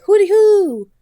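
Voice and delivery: high, loud call of "Hooty hoo". Shown as a demonstration. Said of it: "these sayings that you guys hear".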